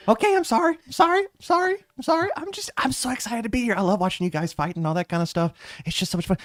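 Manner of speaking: very high, giddy voice; excited apology. Acted. Specs gushes apologies to the fighters, giddy. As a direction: gush like an eager new hire.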